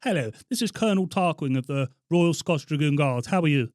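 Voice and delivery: hearty officer voice on a prank call; Colonel Tarquin. Pretend. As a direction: announce yourself loudly as a colonel. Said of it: "expecting the voice there".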